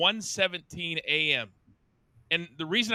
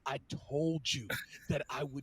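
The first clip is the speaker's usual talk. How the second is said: menacing threat; 3 semitones lower than usual. Retold or imitated. Choking attacker threatening.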